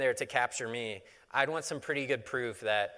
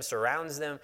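ordinary delivery

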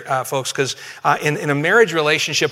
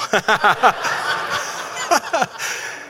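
breathy chuckle; 7 semitones higher than usual. Real, not acted. Laughs at own joke.

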